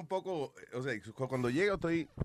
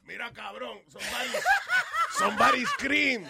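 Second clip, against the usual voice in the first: laughing friend; 9 semitones higher, 4 dB louder than usual. Retold or imitated. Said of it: "y le dice".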